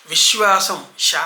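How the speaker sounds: విశ్వాసం with distinct sh, vish-waasam; careful corrective విశ్వాసం, sh not s; clear sha. Shown as a demonstration. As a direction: bring out the శ in విశ్వాసం as sh. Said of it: "స్పష్టంగా చెప్పండి".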